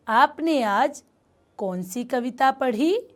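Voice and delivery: ordinary talk, nothing marked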